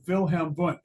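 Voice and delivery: Wilhelm Wundt in a German accent, W said as V. Shown as a demonstration. Lecturer showing students the correct name, German accent. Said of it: "say it correctly with a German accent".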